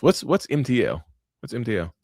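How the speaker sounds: usual speaking voice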